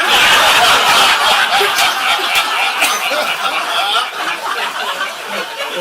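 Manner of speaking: long, breathy, high burst of laughter; loud laugh. Real, not acted. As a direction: fall about laughing. Laughs at own punchline.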